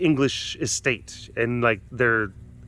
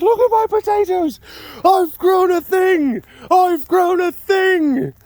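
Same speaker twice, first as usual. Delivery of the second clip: much higher, louder, slow gleeful crowing; excited Jeremy; 4 dB louder, slower than usual. Retold or imitated. Imitating Jeremy showing off his potatoes, overjoyed and high. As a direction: crow it out like a giddy new farmer. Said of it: "he was so excited".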